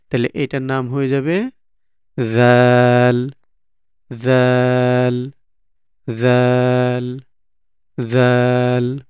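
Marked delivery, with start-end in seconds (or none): 2.2-9.0 "জাল" held long with a buzzed start; drawn-out letter name. Shown as a demonstration. Teacher models the dotted letter, "জাল" drawn out long. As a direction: stretch "জাল" slowly on a level note. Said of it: "এরকম".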